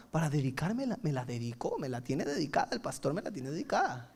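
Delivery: low, soft aggrieved mutter; private grumbling. Acted. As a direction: grumble under your breath like an offended listener. Acting a churchgoer grumbling privately.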